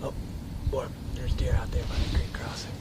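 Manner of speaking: hushed whisper so deer won't hear; wildlife watching whisper. Real, not acted. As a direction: breathe the words, no voice. Hunter spots deer, keeps hidden.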